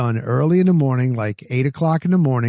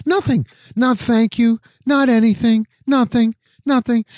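much higher, exasperated disbelief; indignant; 8 semitones higher than usual. Real, not acted. Venting to listeners that the stranger gave no thanks, exasperated and much higher. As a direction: sound appalled that nobody said thanks.

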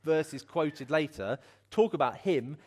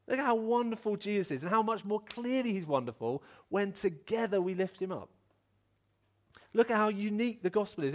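higher, building sermon voice; rousing preaching; 7 semitones higher than usual. Acted. Preacher urging jaded churchgoers to see its glory, rousingly. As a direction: lift the congregation with each look at.

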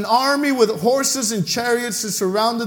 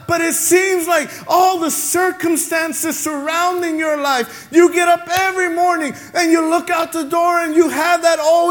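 raised, higher preacher's call with shouted peaks; full-force preaching; 6 semitones higher than usual. Acted. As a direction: drive it home to the congregation.

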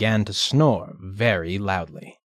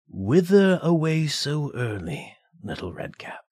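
wolf voice; 6 semitones higher than usual. Acted. Wolf questions the girl.

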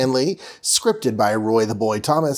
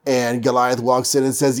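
ordinary delivery

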